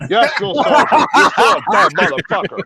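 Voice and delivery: high, loud sneering sergeant voice; drill sergeant retort. Retold or imitated. Drill sergeant calling the recruit dumb, high and loud. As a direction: snap it back as the sergeant.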